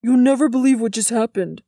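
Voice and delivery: put-on eager boy calling across a yard. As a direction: call out big news to a friend. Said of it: "Jordan called from Sarah's yard".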